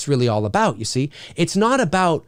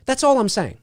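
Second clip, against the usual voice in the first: ordinary talk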